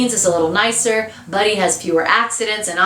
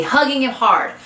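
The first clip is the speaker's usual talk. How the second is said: plain reading voice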